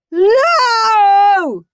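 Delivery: put-on high, loud boy's scream; furious child's scream. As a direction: scream the refusal. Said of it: "screamed Horrid Henry".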